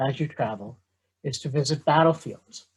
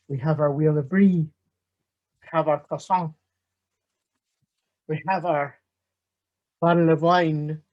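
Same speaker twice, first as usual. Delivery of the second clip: bad French accent; 4 dB louder than usual. Professor opening his history lecture in a bad French accent. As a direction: ham up a French accent like a comic detective. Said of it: "the very bad French accent learned from Peter Sellers".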